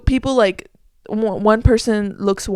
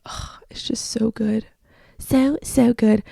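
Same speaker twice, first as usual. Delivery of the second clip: hushed gush; 4 dB quieter, slower than usual. Real, not acted. Gushing about the song.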